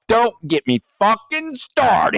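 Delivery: high, drawn-out mock rant; mock angry outburst. Co-host re-enacts their own earlier outburst, mock angry. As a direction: stretch it out in exaggerated high-pitched indignation.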